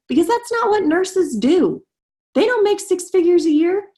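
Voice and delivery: high, mocking voice of the doubters. Nurse mocking the belief that nurses can't earn, in a high sneer.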